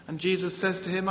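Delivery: usual speaking voice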